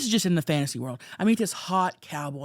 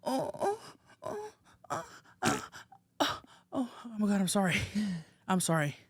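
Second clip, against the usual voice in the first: mock moaning then sorry; 7 dB quieter than usual. Role-playing the fart moment.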